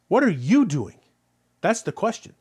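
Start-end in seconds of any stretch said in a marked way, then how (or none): 0.1-1.0 "you" swelling up, then falling; stress on YOU. Shown as a demonstration. Preacher challenging the congregation, "you" stressed. Repeats question pointedly to listeners.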